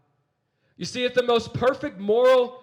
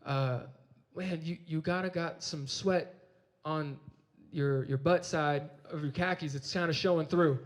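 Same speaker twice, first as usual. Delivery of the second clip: put-on shy hesitant girl; 4 semitones lower, 6 dB quieter than usual. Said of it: "so shyly and so hesitantly".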